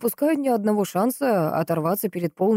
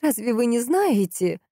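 higher, slowed indignant question; mildly affronted, drawn out and pitched up; 6 semitones higher, slower than usual. A narrator's reading. Valentina challenges the clueless investigator, indignant. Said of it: "возмутилась женщина".

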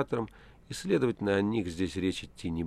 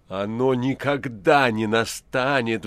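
sudden slow, louder cry with weight on никогда; terrible shout; 3 semitones higher, 8 dB louder, slower than usual. A narrator's reading. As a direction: roar it so the prisoner recoils. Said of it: "вдруг закричал Пилат таким страшным голосом".

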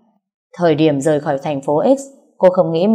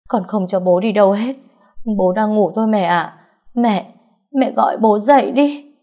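higher, insistent grieving voice; pleading denial; 4 semitones higher than usual. A narrator's reading. Daughter insisting her father is only sleeping, pleading. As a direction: refuse to believe it, plead with mother.

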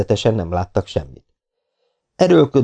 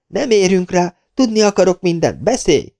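impatient command; 11 semitones higher than usual. Acted. Angry woman demanding.

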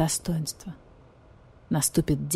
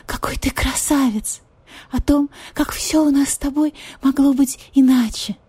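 higher voice, pleading, half-whispered; repentant cat lady; 6 semitones higher, 3 dB louder than usual. Acted. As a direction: pour out regret to him. Marquise repentant plea.

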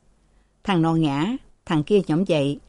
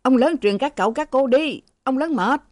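loud; 7 semitones higher than usual. Acted. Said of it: "Hai điều nói to".

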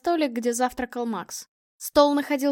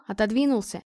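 usual speaking voice

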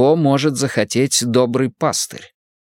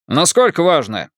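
put-on Irish accent; 5 semitones higher than usual. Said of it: "Ирландский акцент работал на всю катушку".

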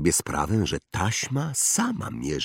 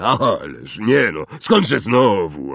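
loud, drawn-out giant's protest; gruff giant, hasty denial; 4 semitones higher, 8 dB louder, slower than usual. Acted. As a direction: deny it too emphatically, in a big voice. (olbrzym głośno zaprzecza)

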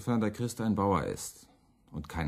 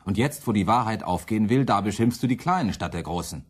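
accusing; 5 semitones higher, 6 dB louder than usual. Acted. Müntzer accuses Luther.

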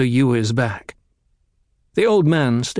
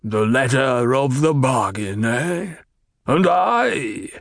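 spat-out Baron voice; slower than usual. A narrator's reading. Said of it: "he spat the words out".

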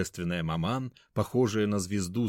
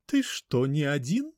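affected lady voice; 6 semitones higher than usual. A narrator's reading. Мать из-за двери.

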